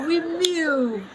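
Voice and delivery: excited echo. Real, not acted. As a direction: echo it back high and thrilled. Host gleefully echoes guest's answer.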